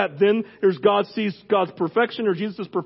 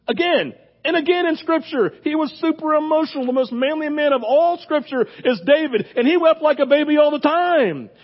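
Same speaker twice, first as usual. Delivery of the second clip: higher, impassioned preaching; rally the room, voice lifted high; preaching at full force; 8 semitones higher than usual. Acted.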